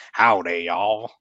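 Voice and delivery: very slow, drawn-out Texan drawl; badly faked Texan greeting; attempted Texan accent. Shown as a demonstration. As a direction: stretch it out in mock Texan. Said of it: "like, a Texan one".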